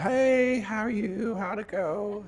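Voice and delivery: forced cheerful greeting. Retold or imitated. Preacher answering the anxious caller's phone, falsely cheery high greeting. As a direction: sound breezy to hide your nerves. Greeting the woman after her scan.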